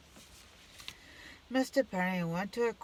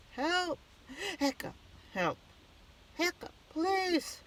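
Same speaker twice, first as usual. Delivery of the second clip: high, pleading cries for help; frightened shouts; 11 semitones higher, slower than usual. Acted. Mister Penny chased by the monsters, shouting in fright. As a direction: scream for help, panicked. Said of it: "shouted Mister Penny".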